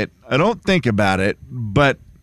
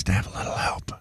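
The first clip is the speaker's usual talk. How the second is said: breathy whisper aside; 7 semitones lower, 10 dB quieter, much faster than usual. Real, not acted. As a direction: whisper it under your breath. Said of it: "Why are you whispering?".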